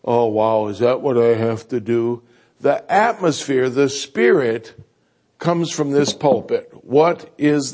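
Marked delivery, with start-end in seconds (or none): 0.0-2.2 lower, grudging reply; a touch of reluctant dread; reluctant sigh. Retold or imitated. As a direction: sound put out by the call. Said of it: "Instead of".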